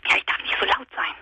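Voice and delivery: hushed whisper; whispering so nobody wakes; whispering on the phone. Real, not acted.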